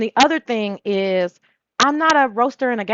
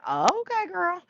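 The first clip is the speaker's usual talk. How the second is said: much higher, soft sassy reply; sassy okay girl; 9 semitones higher, 5 dB quieter, slower than usual. Retold or imitated. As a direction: answer with playful sass. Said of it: "And I'm like".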